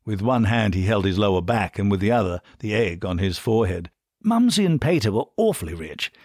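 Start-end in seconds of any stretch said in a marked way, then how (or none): 4.2-6.1 higher, upper-class British voice. A narrator's reading. Bernard begins the family history, thoroughly upper-class British. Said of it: "his thoroughly upper-class British voice".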